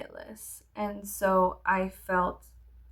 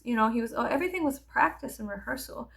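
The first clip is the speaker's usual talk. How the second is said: usual speaking voice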